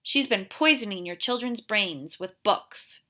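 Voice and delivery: ordinary delivery, nothing marked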